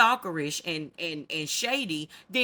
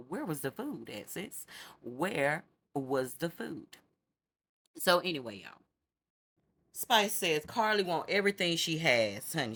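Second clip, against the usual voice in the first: ordinary talk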